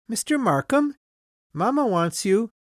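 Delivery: put-on high little child's voice. A narrator's reading. Child calls Markham to Mamma, high little voice. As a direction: pipe up like a small child. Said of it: "I heard a little voice".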